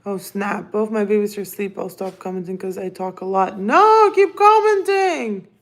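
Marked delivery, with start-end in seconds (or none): 3.6-5.5 high, drawn-out cry; slow, high-pitched loud objection; loud playful protest. Real, not acted. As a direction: call out pleadingly, high and loud. Protests to viewer who will stop.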